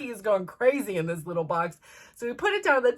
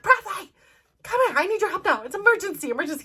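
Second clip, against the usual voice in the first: high, urgent call for help; 9 semitones higher, faster than usual. Retold or imitated. Mom calling son to the emergency, high urgent cry. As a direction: summon someone fast, pitched way up.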